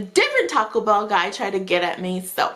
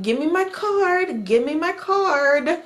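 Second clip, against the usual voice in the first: impatient demand; 5 semitones higher, slower than usual. Retold or imitated. Demanding her card back.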